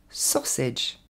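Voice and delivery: SAUSAGE as "SAW-sedge", slowly; teaching model of English SAUSAGE; correct English pronunciation. Shown as a demonstration. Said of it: "va se dire".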